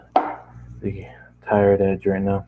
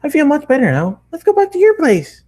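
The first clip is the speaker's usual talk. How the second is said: put-on very high, louder bubbly girl voice; bouncy; 6 dB louder than usual. A narrator's reading. Pinky bouncing back home with Matt, very high and bubbly. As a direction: soar up, bursting with cheer. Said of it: "she said in a bouncy tone".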